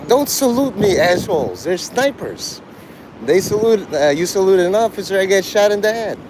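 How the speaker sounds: high-pitched mock warning, lightly acted; Forrest Gump scene. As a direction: imitate an exasperated officer under sniper threat. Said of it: "you watch Forrest Gump?".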